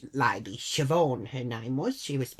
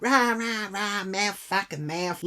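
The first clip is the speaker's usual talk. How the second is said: higher-pitched mocking blather; mock ranting; 4 semitones higher, slower than usual. Imitating the Irish lady mouthing off, mock ranting. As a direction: mimic someone mouthing off. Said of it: "Siobhan was just like".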